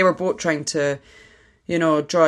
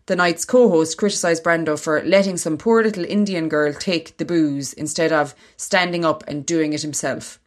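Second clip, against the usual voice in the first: reading aloud. Acted. Reads an article aloud.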